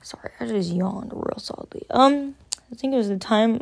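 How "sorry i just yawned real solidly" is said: breathy whispered apology just after a yawn; whispered aside. Real, not acted. Telling viewers about the yawn, a soft whispered aside. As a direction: drop to a whisper to excuse the yawn.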